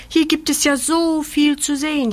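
high-pitched wide-eyed wonder; amazed. A narrator's reading. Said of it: "staunt Julia".

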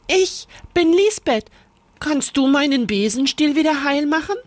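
high, eager little witch; bright asking girl, quick; Lisbeth. A narrator's reading. Lisbeth asks the witch to fix her broom, high and quick.

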